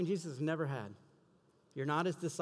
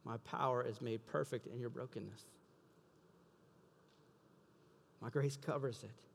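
lower, much softer reassurance; quiet, tender promise spoken as God; 4 semitones lower, 6 dB quieter than usual. Acted. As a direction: drop low and gently comfort the listener. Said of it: "He says".

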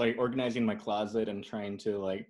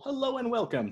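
radio host greeting; 13 semitones higher than usual. Retold or imitated. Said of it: "That's the origin tale".

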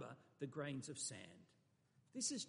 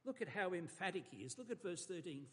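usual speaking voice

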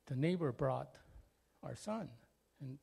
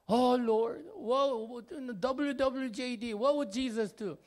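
high, loud desperate plea to the Lord; exasperated father praying aloud; anguished cry to God; 8 semitones higher, 6 dB louder than usual. Retold or imitated. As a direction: cry out to God, at the end of your rope. Father crying out in prayer.

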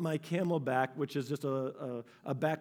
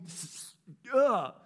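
normal talking voice

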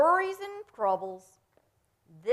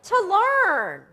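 loud, high-pitched exclamation; big loud burst, very high; emphatic exclamation; 13 semitones higher, 14 dB louder, much slower than usual. Real, not acted. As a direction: cry it out emphatically, far above normal pitch. Mock-protesting child's answer about school.

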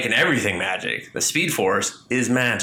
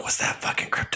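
mock whisper; 5 dB quieter, faster than usual. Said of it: "you're just like".

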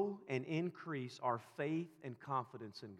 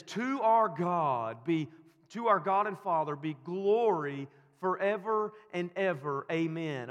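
preaching doxology; 4 semitones higher, 8 dB louder than usual. Acted. Preacher quotes Paul's doxology.

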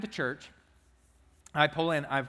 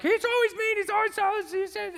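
put-on much higher, whiny child complaining; upset first grader; 4 dB louder than usual. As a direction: plead like a hurt little kid. Upset boy complains on bus.

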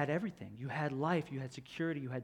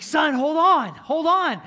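much higher, louder fatherly cry; urgent shout; 13 semitones higher, 18 dB louder, slower than usual. Retold or imitated. Father shouting to his son in the crack, urgent cry. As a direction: yell down to save your son. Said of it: "he's like".

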